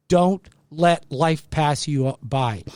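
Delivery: ordinary talk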